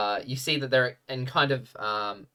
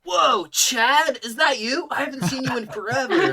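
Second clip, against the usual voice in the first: high, loud reunion voice breaking into laughter; overjoyed tabaxi; 12 semitones higher, 7 dB louder than usual. Acted. Amesh greeting old friend Chad, high, loud and laughing. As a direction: greet a long-lost buddy, way up high and laughing. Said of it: "He's like".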